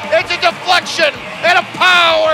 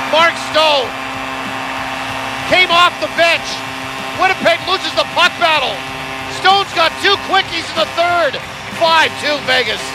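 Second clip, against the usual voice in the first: excited goal call; 4 dB louder than usual. Acted.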